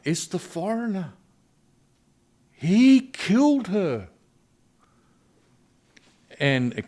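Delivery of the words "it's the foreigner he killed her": high, loud, drawn-out accusing shriek; family member shrieking that the foreigner killed her; accusing cry. Retold or imitated. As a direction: cry out the blame like a grieving relative turning on a stranger. Grieving family blames the foreigner.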